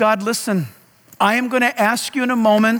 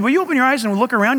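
normal talking voice

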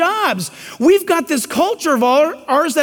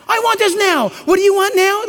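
higher, mock bratty kid then parroting parent; mock demanding kid; 7 semitones higher than usual. Preacher mocks a kid ordering his parent, higher and bratty. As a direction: ape a bossy kid and an echoing parent.

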